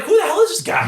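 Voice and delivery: high-pitched, incredulous outburst; astonished. Retold or imitated. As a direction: replay your own stunned disbelief. Astonished reaction on reading his record.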